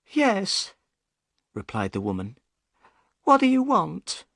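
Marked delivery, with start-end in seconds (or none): 0.1-0.7 high, upset woman's voice; high-pitched boatman's wife. Acted. Woman answers the door. 3.2-4.2 high, upset woman's voice; high-pitched boatman's wife. Acted. Wife questions the stranger.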